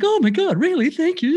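high-pitched, mock-delighted gushing; flattered surprise. Friend retelling a flattered person's reply on the phone, mock gushing.

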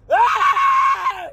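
very high, loud sustained scream; frustrated scream. Real, not acted. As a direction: let out a piercing yell of rage.

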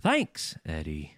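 British for the first word, lose all will on "Eddie"; mocking half-British accent. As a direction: imitate a British accent deflating halfway. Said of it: "the thanks is British, and then the Eddie is just".

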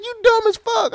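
very high pitched, loud incredulous scolding; exasperated scold. Retold or imitated. Man berating girlfriend over her car, high exasperated scold. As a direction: let her have it, half laughing.

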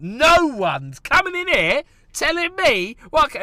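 mock indignant rant. Voicing the comic's over-the-top reply to the woman, indignant. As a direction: bristle at being told off.

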